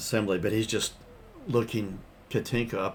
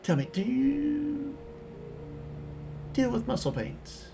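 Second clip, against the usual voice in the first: put-on high, creaky old prospector; wheedling old-timer voice, slow; 13 semitones higher than usual. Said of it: "like an old prospector".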